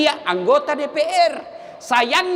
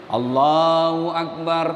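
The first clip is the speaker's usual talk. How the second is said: imam's takbir; 9 semitones lower, slower than usual. Retold or imitated. Imam's call in prayer.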